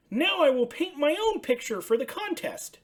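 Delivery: high, bright puppet voice for Ernie; excited high Ernie calling out. Acted. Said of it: "he said".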